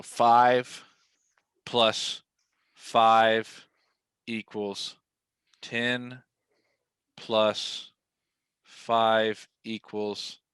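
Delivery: very slow, halting sums, one word at a time; playing dumb. Agent feigning bad math before a client, painfully slow. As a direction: add it up laboriously, long pause after every word. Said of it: "they would think I'm so dumb".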